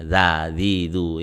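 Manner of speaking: unthickened zal in "za, zi, du"; thin zal. Shown as a demonstration. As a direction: drill "za, zi, du" with tongue back down. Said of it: "itu zal".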